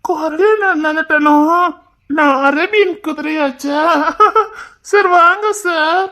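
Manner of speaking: high, very slow sugary okay; hammed-up falsetto lady voice; put-on girly voice. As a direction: coo it like a coy young woman. Keeps the put-on female voice.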